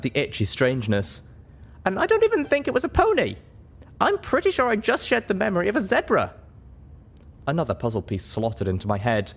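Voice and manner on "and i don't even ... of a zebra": put-on much higher, lighter young mare voice; a high young pony heroine choosing her words; heroine's voice. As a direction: speak up as the small, earnest heroine. Heroine reveals a discovery.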